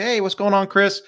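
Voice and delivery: light up as you greet the guard; greeting the security guard by name, high and friendly; friendly hail. Retold or imitated. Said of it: "I see the security guard and I say".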